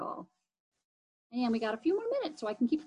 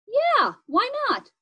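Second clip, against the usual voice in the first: eager; 12 semitones higher, 4 dB louder, slower than usual. Acted. Excited invite.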